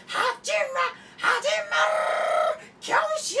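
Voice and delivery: very high, strained screaming chant; straining high shout. Acted. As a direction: scream it until the voice cracks. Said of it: "マジで喉潰れた".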